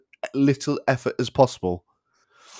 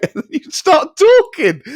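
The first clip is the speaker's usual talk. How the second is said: high, loud, breaking into laughter; tickled, voice climbing as laughter takes over; laughing; 7 dB louder, slower than usual. Real, not acted. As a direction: squeeze the words out through giggles. Explains why he got tickled.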